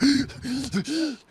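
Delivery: high, sharp gasps for air; reviving gasp. Acted. Wounded man drinks the potion, gasping high. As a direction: gasp back to life, acted. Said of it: "he drinks it, and he's like".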